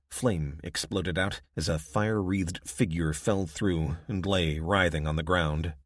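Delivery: ordinary delivery, nothing marked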